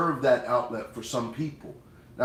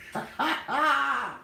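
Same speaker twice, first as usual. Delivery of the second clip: high, delighted laugh; real laugh; 12 semitones higher than usual. Real, not acted. Host reading viewer's fried chicken comment, high laugh.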